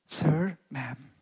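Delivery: low, hushed and slow, as if moved to tears; quiet, emotional retold plea; tearful plea. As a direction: softly voice a weeping man's halting request. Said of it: "mencucurkan air mata".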